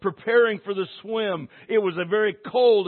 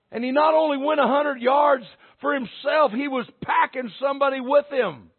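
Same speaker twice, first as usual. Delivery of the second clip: raised, emphatic sermon storytelling; full-force preaching; 5 semitones higher, 4 dB louder than usual. Real, not acted. Preacher telling church of the collapsed player, fired-up preaching.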